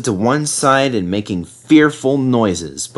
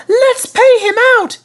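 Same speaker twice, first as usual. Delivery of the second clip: screaming coaches; 5 dB louder than usual. A narrator's reading. Coaches angry at Sir Handel for bumping them, screaming. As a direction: shriek it like outraged coaches. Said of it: "screamed the coaches".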